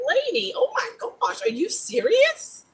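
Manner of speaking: exasperated disbelief at the lady; retold exasperation. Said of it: "And I'm like".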